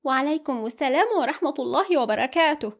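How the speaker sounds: mock-polite greeting, quick; sarcastic. A narrator's reading. Amal mocking her fiancé's missing greeting, sarcastic. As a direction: needle him with exaggerated courtesy. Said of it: "قالت أمل ساخرة".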